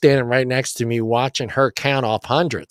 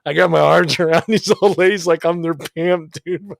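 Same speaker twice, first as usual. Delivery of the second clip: higher pitched, breaking into laughter; laughing; 4 semitones higher than usual. Real, not acted. Friend telling of posing with old ladies, laughing. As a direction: talk through a real laugh. Laughing at his own story.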